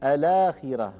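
الآخرة with the hamza dropped, the lam taking its vowel; quick demo of الآخرة after transfer; after naql. Shown as a demonstration. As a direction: say الآخرة as لَاخرة. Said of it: "فصار اللفظ".

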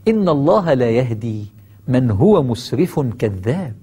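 slow, drawn-out Quranic recitation. Acted. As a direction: recite the verse slowly and solemnly. Quran verse recited slowly.

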